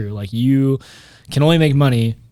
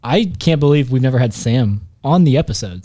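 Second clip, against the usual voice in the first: usual speaking voice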